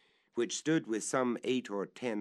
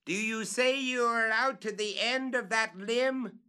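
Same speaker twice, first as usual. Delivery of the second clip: much higher, louder scream of a question; 13 semitones higher, 5 dB louder than usual. Acted. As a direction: scream up in disbelief. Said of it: "fairly screamed Legrand".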